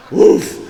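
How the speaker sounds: a loud, sudden woof of shock; stunned woof. Retold or imitated. After the lightning strike, a loud stunned woof. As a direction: blurt it out like you just got zapped. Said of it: "Like, I was like".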